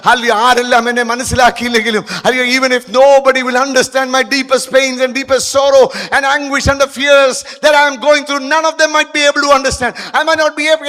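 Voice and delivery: loud, high, impassioned sermon voice; a pastor urging the hurting to call on God, at full force; fiery preaching. Acted.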